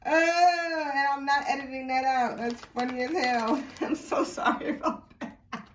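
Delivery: real laughing fit, high-pitched; high, breaking into laughter while apologising; cracking up. Real, not acted. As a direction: squeal it out through helpless laughter. Cracking up after snorting on camera.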